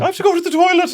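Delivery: mock his dainty toilet excuse; weird posh voice. Friend mocking his posh toilet voice, squeaky high. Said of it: "that weird little posh voice that you do".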